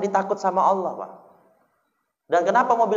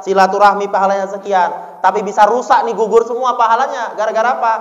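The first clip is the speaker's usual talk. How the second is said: loud, ringing sermon delivery; full-force preaching; 3 semitones higher, 8 dB louder than usual. Acted. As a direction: hammer it home to the crowd.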